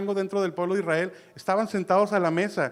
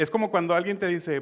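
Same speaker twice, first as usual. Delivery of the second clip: normal talking voice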